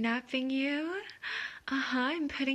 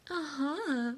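normal talking voice

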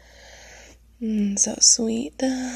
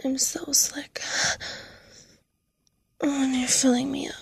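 breathy aroused whisper; 4 semitones higher than usual. Acted. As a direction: whisper it hungrily.

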